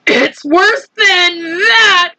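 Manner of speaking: very high, slow frightened cry; police chief crying out in fear. A narrator's reading. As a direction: wail in terror. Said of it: "cried the chief of police".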